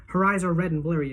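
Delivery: ordinary delivery, nothing marked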